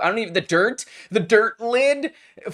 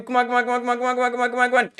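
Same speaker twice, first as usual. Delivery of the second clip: rapid-fire high pleading; urgent stream of pleas; frantic urging; 6 semitones higher, much faster than usual. Real, not acted. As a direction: chant it fast on one high pitch. Urging the game on again.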